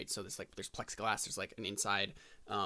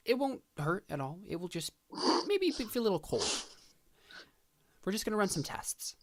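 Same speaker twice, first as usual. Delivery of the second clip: reassuring; 9 semitones higher, 4 dB louder than usual. Acted. Dad reassures the child.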